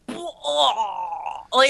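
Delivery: long imitated gagging noise; mock retch. Friend reacting to a gory wound picture, mock retch. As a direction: act out being about to throw up, drawn out. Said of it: "I wanted to throw up".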